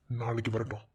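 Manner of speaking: low, soft private mutter; muttering to oneself. Acted. Plotting to question someone at the office, muttering. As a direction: think aloud under the breath.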